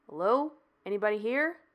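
a loud call into an empty garage. A narrator's reading. Sawyer announces himself in the garage, calling out loudly. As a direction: call out to let them know you're there.

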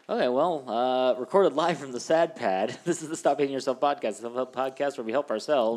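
bright, loud show-intro voice; podcast announcer. Acted.

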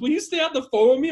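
high, loud, hurried plea; acted-out frightened urgency; imitated panicking mother. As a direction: beg in mock panic.

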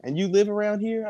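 high incredulous mock voice; the wife can't believe he never heard it, high and incredulous; quoting the wife. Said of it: "she's like".

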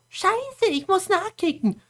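very high mock-panicked voice; hammed-up high alarm; acted alarmed thought. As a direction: squeal it as an imitated worry. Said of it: "denkt sich nur so".

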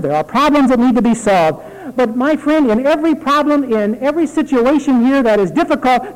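loud, high, driving sermon delivery; fervent preaching. Real, not acted. Pastor urging the congregation to live to help, fervent preaching. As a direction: preach it hard, urging the flock to serve.

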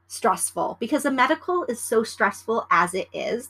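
ordinary talk

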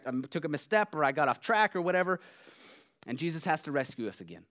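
normal talking voice

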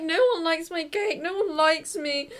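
high, plaintive self-quote; mock sad. Retelling her birthday cake sadness, high mock-sad whine. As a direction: whine it like a sorry-for-yourself child. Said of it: "how sad this makes me".

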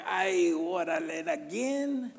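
put-on drawn-out, comic Mexican-accented God. God answering a needy prayer from up there, exasperated Mexican accent. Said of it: "I didn't know Jesus had an accent".

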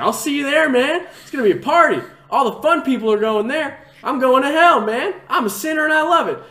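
gleeful shouted taunt at the preacher; taunting yell. Retold or imitated. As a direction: holler back to provoke the preacher. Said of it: "I was like".

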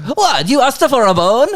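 high, loud incredulous yell; hammed-up shocked shriek of a question; mock outrage. Actress reacting to the thrown bone, loudly incredulous. Acted outraged reply in story.

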